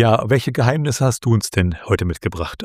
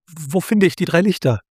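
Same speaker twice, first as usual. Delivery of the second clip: high, urgent question; calling out; 9 semitones higher than usual. A narrator's reading. Luna begs the fading figure for directions, calling out. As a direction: ask urgently, pitched well above the narration. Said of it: "rief Luna".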